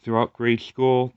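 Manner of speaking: normal talking voice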